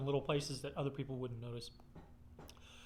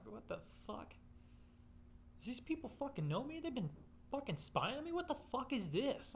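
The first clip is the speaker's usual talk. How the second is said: alarmed disbelief; 9 semitones higher, 6 dB quieter than usual. Retold or imitated. Alarmed reaction to videos.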